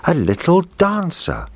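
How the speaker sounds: put-on high, excited little girl; happy cry. Little girl spots the dancer, high happy cry. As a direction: squeal of delight at the toy. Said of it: "cried out happily".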